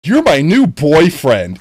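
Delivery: loud, drawn-out mock infatuation; hammed-up smitten declaration; clingy admirer. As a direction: gush slowly like an overattached fan. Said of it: "some people take that as".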